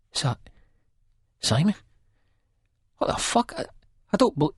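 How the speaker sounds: high, breathless disbelief, words breaking off; stunned stammer. A narrator's reading. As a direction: caught off guard, stumbling over his name. Renton stammers in shock.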